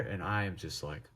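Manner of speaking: ordinary delivery, nothing marked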